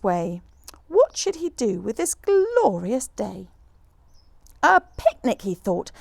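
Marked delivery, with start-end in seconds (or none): 4.6-5.4 bright high "a picnic"; light happy bear voice; excited idea. Acted.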